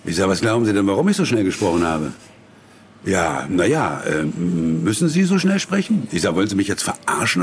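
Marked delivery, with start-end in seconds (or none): 4.8-6.1 high mocking voice of the director. Mocking the director who asks why he speaks so fast, high-pitched. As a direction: ape the director's fussy question.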